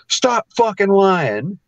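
slow mock accusation. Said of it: "he goes".

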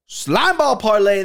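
high, drawn-out hype call; proud announcement. Pretend. Voiced repeat after whispered reveal.

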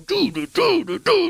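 high sing-song doo doo; playful high doo-doo noise; mock humming. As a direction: hum it high and bouncy. Mimicking hand motion sound.

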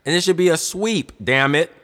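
loud, high emphatic outburst; heated demand for a sweep; fired-up insistence. Real, not acted. Fired-up rant about the series.

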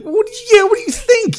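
high, loud incredulous outburst; exasperated disbelief. Retold or imitated. Friend answering Snook's clueless bandage question, loud and incredulous. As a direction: throw it back at him, voice leaping up.

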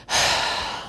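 soft unvoiced tired sigh; weary sigh. Real, not acted. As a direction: let out a long, worn-out sigh. Tired aside after rant.

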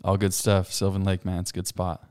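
ordinary delivery

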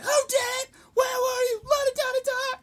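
very high, loud frenzied yell; acted drill-instructor tirade, piercing; mock interrogating scream. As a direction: demand answers, imitating the raging instructor. Instructor screaming questions at recruits.

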